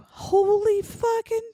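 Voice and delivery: shocked outburst. Retold or imitated. Woman spotting the fugitive on TV, shrieking to Bob in shock. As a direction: gasp it out, then yell to your husband in disbelief. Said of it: "and she goes".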